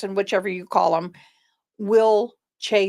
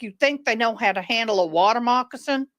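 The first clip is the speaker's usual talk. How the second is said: ordinary talk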